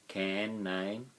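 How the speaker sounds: ordinary talk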